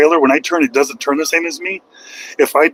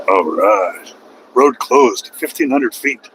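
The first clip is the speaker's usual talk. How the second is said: normal talking voice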